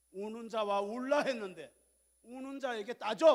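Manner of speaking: loud, high, slowed scolding. Acted. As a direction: rebuke the congregation from the pulpit. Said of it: "약간 목소리가 약간 막 야단치는 느낌인데". (꾸짖듯 큰 소리로 천천히)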